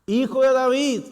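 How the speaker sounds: high, loud plea; Bartimeo's cry. Retold or imitated. Bartimeo crying out to Jesus, loud and high. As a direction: cry out to be heard. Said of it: "le grita".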